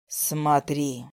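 low, slow booming dragon command. A narrator's reading. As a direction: order her like a giant ancient beast. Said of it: "властно приказал мне голос".

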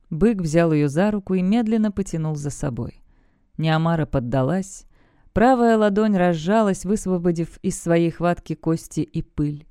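usual speaking voice

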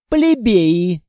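a loud haughty toss; loud, haughty dismissal. A narrator's reading. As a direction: fling it down from above. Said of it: "бросила она надменно".

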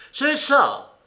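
high, loud eager cry; excited cry. A narrator's reading. Silver recognizing the blind man's name, excited cry. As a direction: burst out with recognition. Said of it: "s'écria Silver".

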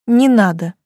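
proud, firm refusal. A narrator's reading. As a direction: refuse with dignity. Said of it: "гордо отказалась я".

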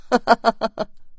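high-pitched staccato laugh; mocking laugh. A narrator's reading. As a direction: burst out laughing at his blunder. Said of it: "叶修的笑声已经传了过来". (高声嘲笑)

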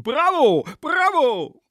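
very high, loud mocking cheers; shouted bravo. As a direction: cheer wildly as a rowdy student. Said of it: "applaudierten und schrien". (laute, hohe Bravo-Rufe)

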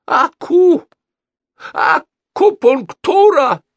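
piercing high, drawn-out shriek; frenzied high-pitched cry; yelling. A narrator's reading. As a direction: erupt into a triumphant yell. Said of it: "завопил консул".

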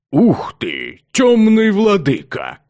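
slow rumbling echo of the sphinx. Acted. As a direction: boom it back like an echo. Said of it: "ответил мне ракочущим эхом".

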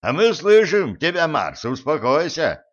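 shouted high and loud to Mars; shouting. Acted. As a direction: yell to calm the god. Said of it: "крикнул Билл".

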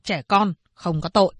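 ordinary delivery

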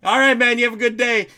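high, loud re-enacted goodbye; shouted farewell. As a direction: call a cheery goodbye across a crowd.

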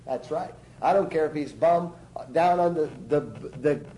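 ordinary delivery

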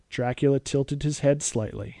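ordinary delivery

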